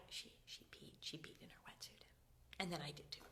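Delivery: hushed whispered confession; unvoiced giggly admission. Real, not acted. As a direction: whisper the embarrassing part.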